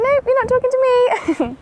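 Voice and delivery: high, loud horse's reply; voicing the horse. Owner speaks for Holly snubbing her, high mock voice. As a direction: put loud, high words in the horse's mouth. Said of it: "Holly's like".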